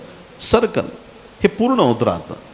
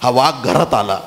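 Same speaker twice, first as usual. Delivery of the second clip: ordinary delivery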